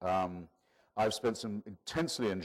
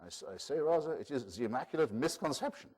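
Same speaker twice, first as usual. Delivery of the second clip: put-on a raised, lightly done Israeli accent; 5 semitones higher than usual. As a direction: imitate his Israeli accent, pitched up. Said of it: "I'll try his voice".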